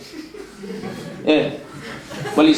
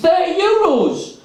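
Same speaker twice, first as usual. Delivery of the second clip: much higher, slow, outraged repeat; incredulous; 11 semitones higher, slower than usual. Retold or imitated. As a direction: echo the price back in disbelief. Narrator repeats price in disbelief.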